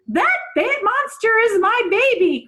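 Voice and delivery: high, loud desperate cry; frantic cry. A narrator's reading. Angela hearing the news, crying out high and loud. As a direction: shout it out for your lost cat. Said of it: "cried Angela Throgmorton".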